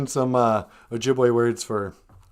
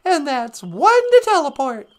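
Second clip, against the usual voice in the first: much higher, louder, little cartoon helper; chirpy robot sidekick offering a ride home; high, squeaky sidekick voice; 13 semitones higher, 5 dB louder than usual. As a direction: squeak it like a tiny robot pal. Voicing a spaceship companion.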